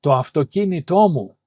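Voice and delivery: "αυτοκίνητό" loud, stressed on κί and τό; two stresses. Shown as a demonstration. Teacher giving another example to learners, double stress on "αυτοκίνητό". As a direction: punch both accented syllables in "αυτοκίνητό μου". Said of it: "This gets two tones".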